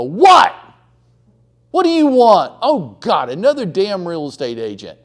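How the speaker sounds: loud, high, snapping "What?" into the phone; rude phone bark. Retold or imitated. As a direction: bark at the caller like they're a pest.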